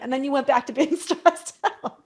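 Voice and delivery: teasing with a laugh; laughing tease. Real, not acted. Host teasing the guest about getting stressed again, laughing.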